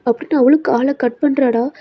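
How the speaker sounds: ordinary delivery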